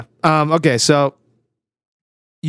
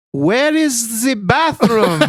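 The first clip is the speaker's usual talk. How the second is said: slow, higher, laughing mock Spanish accent; mock accented English; 8 semitones higher, much slower than usual. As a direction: ham up a tourist speaking English in a Spanish accent. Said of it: "if you talk English in that accent".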